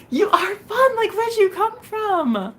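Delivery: gushing delight. Real, not acted. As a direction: gush over a fun viewer. Gushing at a viewer.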